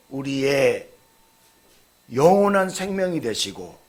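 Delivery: ordinary delivery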